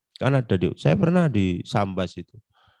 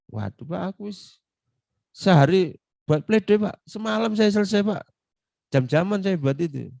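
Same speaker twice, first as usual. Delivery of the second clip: weary complaint; 8 semitones higher than usual. Retold or imitated. Senior lawyer's complaint voiced.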